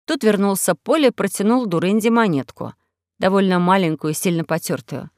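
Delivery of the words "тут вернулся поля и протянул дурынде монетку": usual speaking voice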